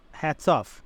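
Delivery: ordinary talk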